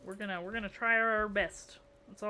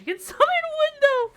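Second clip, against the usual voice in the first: laughing squeal; 14 dB louder than usual. Real, not acted. Laughing at own joke.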